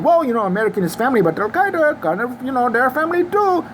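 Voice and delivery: lightly voiced father squirming; mock backtracking father. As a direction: imitate him hedging. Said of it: "be like".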